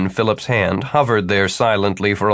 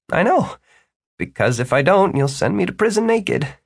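higher, cheeky voice rising at the end; smirking; 9 semitones higher than usual. Acted. Student teasing his mentor about prison, smirking. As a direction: say it through a smirk. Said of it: "Martin smirked".

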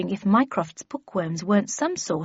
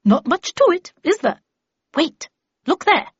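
high, loud, brisk lady's voice; raised, high-pitched character voice; Miss Havisham; 9 semitones higher, 6 dB louder than usual. Acted. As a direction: play a commanding lady, pitched up.

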